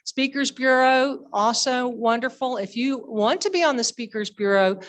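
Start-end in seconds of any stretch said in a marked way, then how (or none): none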